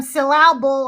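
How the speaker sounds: syllable said jokingly as sih-LAB-ul; syllable deliberately misstressed on its second beat; wrong-syllable stress. Shown as a demonstration. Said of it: "the emphasis on the wrong syllable".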